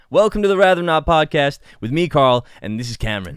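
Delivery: loud American podcast-host intro; American accent. Shown as a demonstration. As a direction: announce the show in a punchy American accent. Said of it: "Pretend to be American".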